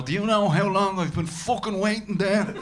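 high, loud furious rant; livid taxi driver. Retold or imitated. Dublin taxi driver turning on passenger, furious and loud. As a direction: snap at the passenger like an angry Dublin cabbie. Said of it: "turn to you livid".

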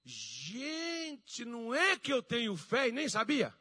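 high, loud mock astonishment with a drawn-out opening; Minas Gerais amazement. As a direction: exclaim like an amazed country local. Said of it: "a gente fala assim".

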